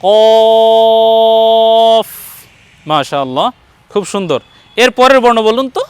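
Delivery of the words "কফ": কফ heavy and loud, vowel held long before the f; thick deep qaf. Shown as a demonstration. Teacher shows a more beautiful way, thick long কফ. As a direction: stretch কফ with a thick back-of-throat q. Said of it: "মোটা উচ্চারণ হবে".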